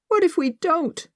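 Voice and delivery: high, anxious question; fearful. A narrator's reading. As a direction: ask it on the edge of tears. Tearful niece fears for her uncle.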